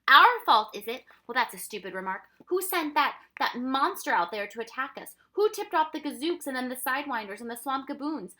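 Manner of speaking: loud, high, heated accusations; angry yelling. A narrator's reading. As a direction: hurl each accusation back at the full yell. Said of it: "so angry that he yelled".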